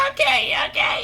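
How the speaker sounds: very high, squealed pleading; mock crying kid. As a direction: imitate a kid tearfully giving in. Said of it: "He starts literally crying".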